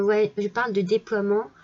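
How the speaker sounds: ordinary talk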